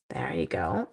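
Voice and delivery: low soft whisper; whispered aside. Real, not acted. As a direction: whisper it to yourself.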